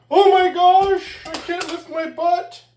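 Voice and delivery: high, loud, slow mock whine; drawn-out mock whine of a critic who can't lift his butt; mocking whiny complainer. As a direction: ham up a whining critic. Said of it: "how many people I see that make complaints".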